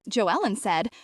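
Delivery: ordinary delivery, nothing marked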